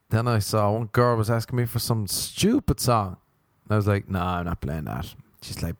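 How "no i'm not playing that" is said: lower, softer refusal; deadpan refusal. Acted. DJ refusing a girl's song request, deadpan. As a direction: turn them down low and unmoved.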